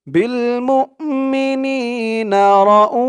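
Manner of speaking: slow chanted recitation; tajweed-style reading; Quran recitation. Shown as a demonstration. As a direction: draw out মুমিনিনা in a recitation tune. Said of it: "teacher recites after spelling out".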